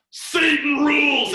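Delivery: high, loud, drawn-out proclamation; dramatic sign reading. Retold or imitated. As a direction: boom out the words slowly for effect. Reads out the scary sign.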